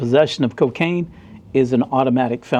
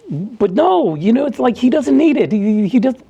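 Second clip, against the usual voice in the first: mock pleading coworker; 9 semitones higher than usual. Coworker begging Ed to let him keep the beer, high whine. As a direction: wheedle like a guilty cop. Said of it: "And he's like".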